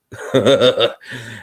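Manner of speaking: short self-amused chuckle; brief laugh after a playful boast. Real, not acted. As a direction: chuckle at your own remark. Chuckles after preening about hair.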